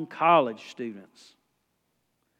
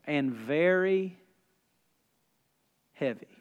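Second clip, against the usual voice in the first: weighted reading; 4 semitones lower, slower than usual. Real, not acted. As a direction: let heavy drop to almost nothing.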